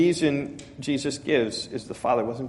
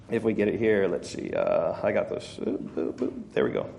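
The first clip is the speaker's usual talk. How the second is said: much softer, low absent-minded mumble; muttering to self; 7 semitones lower, 8 dB quieter than usual. Real, not acted. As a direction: drop right down, as if talking to yourself. Fiddling to find first point.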